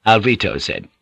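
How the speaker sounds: ordinary delivery, nothing marked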